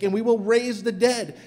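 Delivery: ordinary talk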